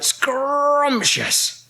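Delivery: high, rolled, long-drawn exclamation; relishing delight. A narrator's reading. Space rabbits get their metal, high relishing cry. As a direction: roll and stretch the start with relish.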